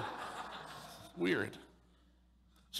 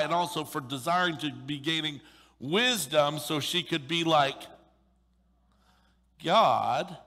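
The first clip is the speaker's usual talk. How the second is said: scripture reading; 3 semitones higher, 4 dB louder than usual. Acted. Preacher reading Genesis to the congregation, weighty. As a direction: read the verse with weight.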